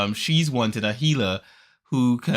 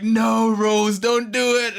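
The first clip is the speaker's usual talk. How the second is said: loud, high cry of warning; alarmed shout; 10 semitones higher, 5 dB louder, slower than usual. Retold or imitated. Everyone trying to stop Rose, alarmed shouting. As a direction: call out to stop her, voice up and urgent.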